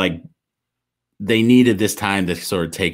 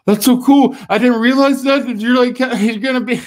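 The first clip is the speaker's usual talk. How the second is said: put-on very high, loud, gushing child's voice; a son overwhelmed that his dad is Captain America; emotional kid; 6 dB louder than usual. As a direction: gush it like an overwhelmed little boy. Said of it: "He's like".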